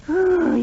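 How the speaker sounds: impatient sigh. Acted. Archie impatient with Betty.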